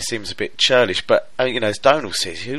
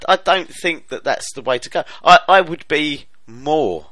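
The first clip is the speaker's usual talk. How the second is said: normal talking voice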